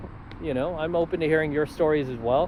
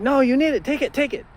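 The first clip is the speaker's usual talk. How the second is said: much higher, louder pushy father; insistent dad; 12 semitones higher, 6 dB louder than usual. Retold or imitated. Dad pushing cash on his broke son, high and insistent. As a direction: push the money on him like a worried dad. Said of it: "he's, like, getting mad".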